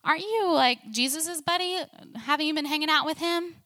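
high-pitched nosy onlooker, questioning; curious onlooker. Retold or imitated. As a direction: raise the voice high, as if recognizing someone. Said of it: "the crowd around him starts recognizing him".

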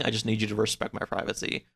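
ordinary talk

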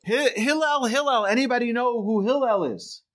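high, carrying mock holler without much volume; imitated calling out. Bettor disturbs Hillel in the bath again, imitated calling out. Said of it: "he sees this individual calling out for Hillel".

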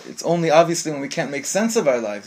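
ordinary talk, nothing marked

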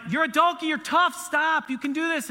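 high, loud pumped-up pep talk; hammed-up cheering on a child to be tough. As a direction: fire up a hurting kid. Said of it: "So I'm like".

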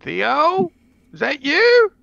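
high calling voice. Acted. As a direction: call out into the woods. Calling a friend in forest.

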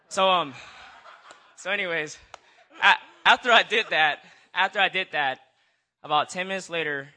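laughing through the words, loud and high. Real, not acted. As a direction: break into nervous laughter while talking. Nervous laughing burst mid-story.

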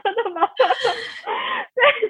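high, teary laugh breaking into the words; half laughing, half crying, real; laughing through tears. Real, not acted. Moved while thanking her mother, laughing through real tears. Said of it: "我现在已经三个人都在流泪".

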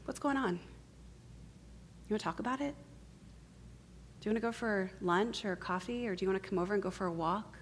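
soft, caring friend; gentle concern. Acted. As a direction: offer comfort quietly, without pushing. Acts a caring friend's gentle response.

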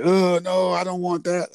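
high-pitched mock whine of kids; kids' disgusted refusal. Voicing the kids refusing the apple.

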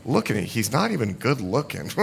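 low, hushed grumble from the pews; mock congregation murmuring doubts, soft and low; unimpressed muttering. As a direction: gossip under your breath, unimpressed. Acts out sceptical congregation muttering.

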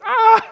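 very high, loud "aah"; hammed-up shriek of panic; mock terrified scream. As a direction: scream in comic terror. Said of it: "because I'm going".

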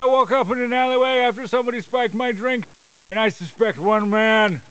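wrestling promo. Acted. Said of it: "you just cut a promo".

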